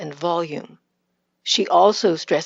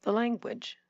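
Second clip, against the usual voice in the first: "language" stressed on the first syllable, short weak ending; softly, with a quick reduced last syllable on "language"; standard unstressed ending; 3 semitones higher, 9 dB quieter than usual. Shown as a demonstration. Coach models North American accent, "language" with unstressed ending. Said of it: "I'll say it with a standard North American".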